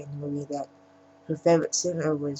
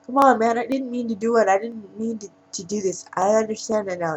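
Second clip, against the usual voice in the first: higher-pitched imitation of begging; mock pleading; 6 semitones higher, 4 dB louder than usual. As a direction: act out a man talking his way out. Wounded man begs in retold scene.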